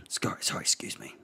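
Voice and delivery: soft whisper; unvoiced whisper; whispered apology. Real, not acted. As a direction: hush the apology.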